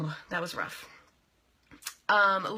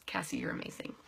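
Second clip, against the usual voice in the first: soft, unvoiced compliment; whispered aside; 3 semitones lower, 10 dB quieter than usual. Real, not acted. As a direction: whisper it warmly. Whispered shout-out after a pause.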